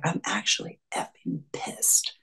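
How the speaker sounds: whispered, soft and breathy admission; a hushed, half-embarrassed confession of anger. Retold or imitated. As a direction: let it slip out as a quiet whisper. Client admits anger, retold.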